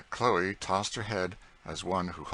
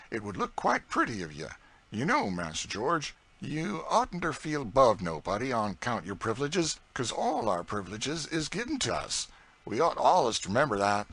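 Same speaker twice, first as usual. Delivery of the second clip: put-on higher, louder proud old cook in broad dialect; Aunt Chloe's voice; 6 semitones higher, 4 dB louder than usual. A narrator's reading. Aunt Chloe boasting to young George, broad proud dialect. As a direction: boast with a scornful toss of the head.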